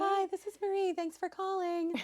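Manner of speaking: high, sing-song phone greeting; bright receptionist answering a call; customer service voice. Shown as a demonstration. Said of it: "That's her customer service voice".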